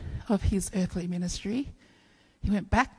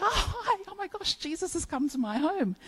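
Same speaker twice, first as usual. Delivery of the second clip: excited; 9 semitones higher, 6 dB quieter than usual. Pretend. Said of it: "it's like".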